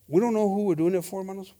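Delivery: normal talking voice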